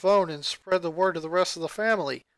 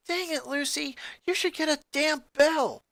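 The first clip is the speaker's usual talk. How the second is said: high, sharp startled complaint; startled angry yell; 9 semitones higher than usual. Acted. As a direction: snap at her sister after the scare. Startled sister yells at Lucy.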